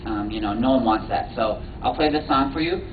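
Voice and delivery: usual speaking voice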